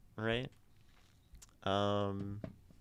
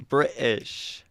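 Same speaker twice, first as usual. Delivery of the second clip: higher, louder stab at British; attempted British accent; 5 semitones higher, 6 dB louder than usual. Shown as a demonstration. Streamer retrying a British accent before giving up, tentatively. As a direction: give British one more doubtful try.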